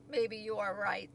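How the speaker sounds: low, doubtful concession; downcast. A narrator's reading. Toad admits the turtle may be right, downcast. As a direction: give in, a touch deflated.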